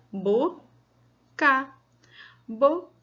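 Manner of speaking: "boca" read out as bo, pause, ca; syllable by syllable. Shown as a demonstration. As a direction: leave a clear gap inside "boca". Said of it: "vamos ler agora aqui a palavra".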